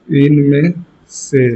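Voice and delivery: very slow, long gaps between word groups; drawn-out words, pausing for writers; question dictation. Shown as a demonstration.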